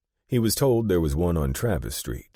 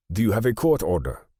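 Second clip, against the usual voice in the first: put-on higher, louder German-accented banker; pointed German-accented question, pitched up; 7 semitones higher, 5 dB louder than usual. A narrator's reading. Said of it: "In a noticeable German accent".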